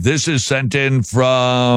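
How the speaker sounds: slow lead-in, "from" held very long; drawn-out intro. Real, not acted. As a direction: stretch the final word to build a wait. Stretching the email sender introduction.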